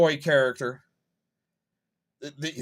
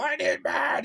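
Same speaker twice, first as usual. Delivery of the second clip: imitated scream; 10 semitones higher than usual. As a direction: mimic someone screaming it, pitched way up. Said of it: "He's screaming it".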